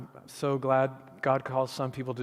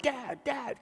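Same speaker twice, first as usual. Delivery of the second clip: put-on kids calling; 11 semitones higher, 4 dB quieter than usual.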